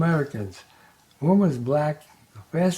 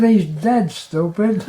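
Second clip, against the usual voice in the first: raised, louder comic retort; impatient widow's comeback, played for laughs; mock exasperation; 5 semitones higher, 5 dB louder, slower than usual. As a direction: set him straight with mock annoyance. Widow snaps back in a joke.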